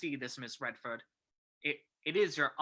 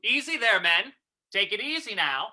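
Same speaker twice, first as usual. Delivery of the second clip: calling out orders; 4 semitones lower than usual. Acted. Commissioner directing his men, calling out. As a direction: project it across a busy scene.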